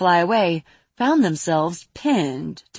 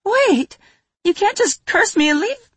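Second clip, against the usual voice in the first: very high, louder protest; 5 dB louder than usual. Acted. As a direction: cry out high and urgent. Toffle protests.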